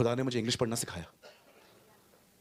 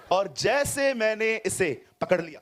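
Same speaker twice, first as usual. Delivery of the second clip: laughing; 8 semitones higher, 5 dB louder, much slower than usual. Real, not acted. Laughing catch in demo.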